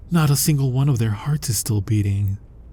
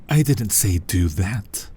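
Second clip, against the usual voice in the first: normal talking voice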